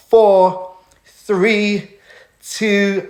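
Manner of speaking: counting the viewers down out loud; hyping the chat with a countdown. Real, not acted. Counting down the live audience.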